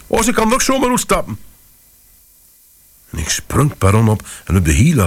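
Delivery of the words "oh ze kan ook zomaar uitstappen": much higher, breathless frightened urging; mother urging her husband to search the platform; gulping panic. Retold or imitated. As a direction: gasp it out, pushing him to go. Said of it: "gulpte ze tegen mij".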